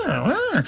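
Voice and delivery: high yelping protest noises; mock struggling growl. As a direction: growl like a kicking horse. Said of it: "kicking and jumping around going".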